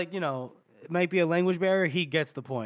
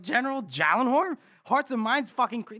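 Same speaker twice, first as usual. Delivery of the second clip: high-pitched acted disbelief; mock incredulous scoff; 6 semitones higher than usual. Hedges mocks the corporal's lofty talk, scoffing. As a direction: scoff at the idea, voice raised in pitch.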